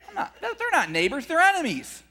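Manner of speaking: high, indignant mock protest with a laugh; mock the people who balk, voice jumping up; mocking objection. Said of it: "And some people are going".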